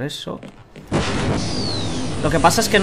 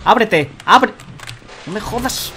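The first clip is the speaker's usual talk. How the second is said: frustrated shout; 8 semitones higher, 3 dB louder, slower than usual. Real, not acted. Door frustration in game.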